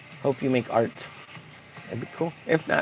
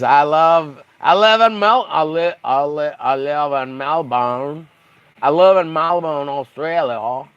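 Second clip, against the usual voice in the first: exaggerated Australian with stretched vowels; mock Australian accent. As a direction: try out a broad Australian accent. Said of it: "Australian speaking".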